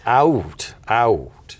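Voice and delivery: "out" with a gliding double vowel; diphthong in out. Shown as a demonstration. Guest demonstrating the Canadian diphthong, "out" said deliberately. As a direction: say "out" deliberately, both vowel sounds showing. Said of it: "is it called a diphthong?".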